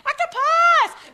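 high screaming alarm, stretched out; frantic shout, drawn long on the second; yelling warning. Retold or imitated. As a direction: scream the warning across the lake. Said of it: "I was yelling at them".

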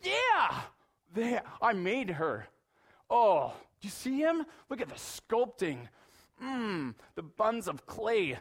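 high, loud, delighted artist voice; playful voice of God admiring a creation; God admiring his work. Pretend. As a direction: gush over the sculpture like a proud artist. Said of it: "he goes".

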